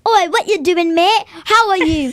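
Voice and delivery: a hint of road rage; imitating rude driver. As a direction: mimic a rude driver yelling from his car. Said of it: "They either say".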